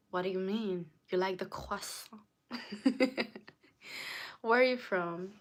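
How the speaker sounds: ordinary talk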